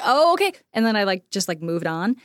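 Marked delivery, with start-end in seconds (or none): 0.0-0.5 surprised okay. Retold or imitated. Retold reaction to refusal.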